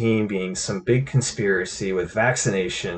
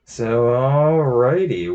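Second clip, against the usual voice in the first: ordinary talk, nothing marked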